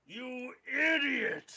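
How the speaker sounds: furious high orc yell, slow; enraged orc bellow. Acted. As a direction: scream it at your fool of a partner. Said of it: "the orc yells".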